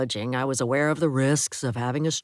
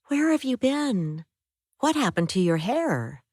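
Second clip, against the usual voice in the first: astonished; 8 semitones higher than usual. Acted. Woman questions found husband.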